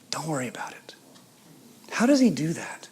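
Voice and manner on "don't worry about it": hushed reassurance. Acted. Prisoner reassuring his followers, hushed. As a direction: drop to a soft near-whisper.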